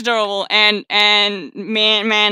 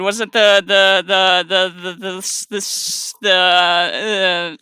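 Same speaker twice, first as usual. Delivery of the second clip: stuck repeating one word; repeated false starts ending in exasperation; tongue-tied stammering; 2 semitones lower, slower than usual. Real, not acted. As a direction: stumble on the same word, then give up. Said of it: "I cannot speak today".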